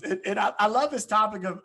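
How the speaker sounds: usual speaking voice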